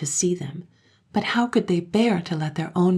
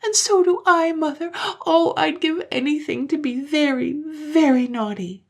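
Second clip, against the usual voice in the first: put-on high, louder tearful child voice; crying sadly; 11 semitones higher, 4 dB louder than usual. Blue Eyes crying into mother's shoulder, high and sad. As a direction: ache with longing. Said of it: "cried sadly".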